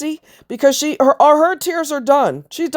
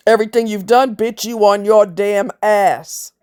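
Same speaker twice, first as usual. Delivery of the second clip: ordinary talk, nothing marked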